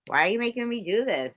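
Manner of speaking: exasperated whine. Real, not acted. Grossed-out complaint.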